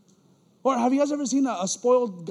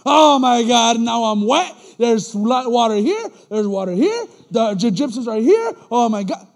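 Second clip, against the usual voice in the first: loud, hammed-up griping; mock complaining; 9 dB louder than usual. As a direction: whine loudly in mock dismay.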